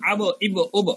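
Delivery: আব, ইব, উব each ending in a bounced b; qalqalah bounce. Shown as a demonstration. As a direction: release every final b of আব, ইব, উব with a short echo. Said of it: "এরকম একটা উচ্চারণ". (আব, ইব, উব-এর শেষে ধাক্কা দেওয়া ব)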